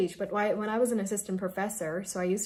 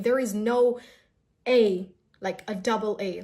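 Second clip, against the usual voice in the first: usual speaking voice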